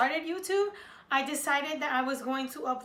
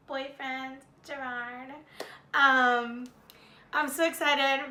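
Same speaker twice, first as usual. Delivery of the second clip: ordinary talk, nothing marked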